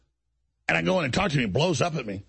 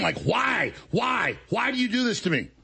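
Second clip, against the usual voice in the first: higher, pleading why; exasperated; 7 semitones higher than usual. Real, not acted. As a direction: throw up your hands at him. Venting at the betrayal.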